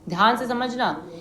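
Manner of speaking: normal talking voice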